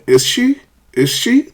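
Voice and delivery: "is she" swept sharply up; high upward lift on "she"; rising tag question. Shown as a demonstration. Teacher models a tag question, rising intonation. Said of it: "That's a question".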